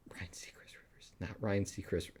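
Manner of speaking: breathy whispered correction. Real, not acted. Streamer correcting the name to chat, whispered. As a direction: whisper the name like a secret aside.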